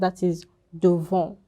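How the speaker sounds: ordinary delivery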